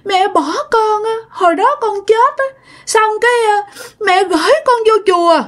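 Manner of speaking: put-on high, tearful toddler speech; child spirit weeping to its mother; crying child voice. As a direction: whimper it like an abandoned little kid. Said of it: "vừa nhìn vừa khóc".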